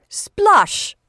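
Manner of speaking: high, loud scornful scoff. Acted. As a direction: scoff mockingly. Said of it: "remarked Marjorie again".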